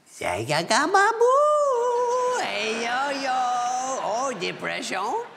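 high, nonsense-babble "babu" with a slow "depression"; Sandler's goofy voice. Retold or imitated. Friend imitating Adam cheering him up after a loss, goofy voice. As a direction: imitate Adam's silly consoling gibberish. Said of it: "whispered to me".

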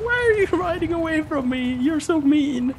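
very high, mock-wounded whine; retold whiny protest. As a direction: complain in a put-upon squeaky voice. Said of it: "I was like".

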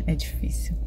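breathy whispered aside; mother whispering how hard drop-off is. Real, not acted. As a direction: let the words slip out in a whisper. Emotional aside after drop-off.

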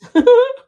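loud, high laugh; burst of laughter. Real, not acted. Laughing at her own joke.

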